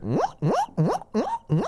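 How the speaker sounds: high squeaky guinea pig squeaks; guinea pig noise. Shown as a demonstration. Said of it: "I can make a guinea pig noise".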